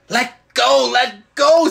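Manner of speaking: high, loud pained scream. Acted. Polly screams as the witch grips her hair. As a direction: shriek as your hair is pulled. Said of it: "screamed Polly".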